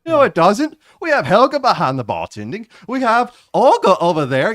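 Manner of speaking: put-on Melvin's loud, high voice wandering between accents; shifting-accent voice. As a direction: argue loudly, never settling on one accent. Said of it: "his voice always changes as he talks".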